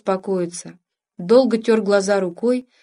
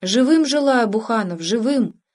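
ordinary delivery, nothing marked

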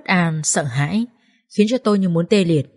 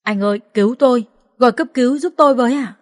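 rising, louder pleading; desperate begging; 5 semitones higher, 3 dB louder than usual. Acted. Injured woman on the ground begging passersby, desperate plea. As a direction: beg the stranger for help. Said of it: "van xin".

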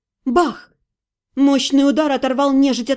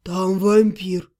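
hoarse whisper; 7 semitones lower, much slower than usual. Acted. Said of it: "хриплым шёпотом сказал".

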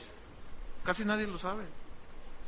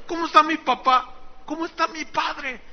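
eager Joseph; 9 semitones higher, 4 dB louder than usual. Acted. Joseph voiced reunited with brothers.

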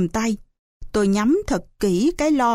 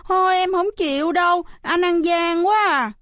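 petulant protest; 7 semitones higher than usual. A narrator's reading. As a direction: protest like a cheated little kid. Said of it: "nó giãy nảy". (giãy nảy, giọng trẻ con cao)

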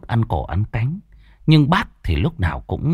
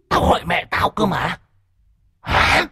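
higher, a touch of an uncanny spirit voice ending in a sharp high "Hả"; mock the mother with an unnatural edge; strange cold voice; 10 semitones higher than usual. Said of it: "cái giọng nói không phải như thường ngày".

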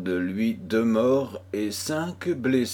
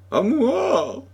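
very high, desperate call; crying for help; 4 dB louder than usual. A narrator's reading. Girl calls once more to the fallen chevalier, crying out. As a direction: cry out high for rescue. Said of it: "cria encore Loïse".

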